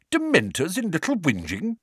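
high-pitched, astonished question; greatly surprised. A narrator's reading. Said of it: "in a tone of great surprise".

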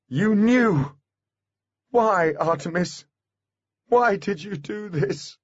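high, distraught cry easing into a slow question; anguished accusation. A narrator's reading. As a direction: demand a reason, heartbroken. Holly confronts Artemis, feeling broken.

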